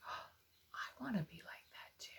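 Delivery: breathy hushed wish to be good; soft whispered wish. Acted. Teacher voicing an inspired child, in a soft whisper. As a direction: whisper it like a quiet resolve. Imagined child wanting to follow.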